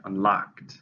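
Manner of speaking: "unlocked" said un-LOCKED, second syllable stressed; teacher models "unlocked" as un-LOCKED. Shown as a demonstration. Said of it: "stresses on the second".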